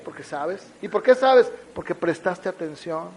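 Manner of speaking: ordinary talk, nothing marked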